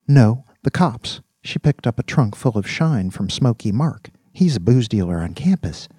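put-on mildly eager young man spilling details; a touch of keen chattiness. A narrator's reading. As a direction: explain it keenly, wanting to be believed. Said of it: "said Virgil eagerly".

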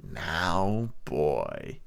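low, cold, menacing beggar; cruel cold voice. A narrator's reading. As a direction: chill the boy with a cruel threat. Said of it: "a voice so cruel and cold and ugly".

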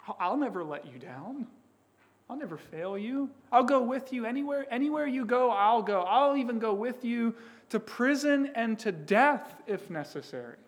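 Peter rising to an eager, fast boast; lightly voiced Peter, promising too much; a hint of eager Peter.

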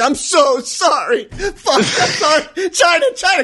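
high, wailing, laughing fake sob; mock sobbing apology. Mocking John Cena apologizing to China in a high sobbing wail. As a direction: ham up a groveling whimper. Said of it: "And he's like".

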